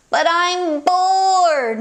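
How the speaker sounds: slow drawn-out complaint; whiny bored. Acted. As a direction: stretch it out, bored child. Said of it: "Maury exclaimed".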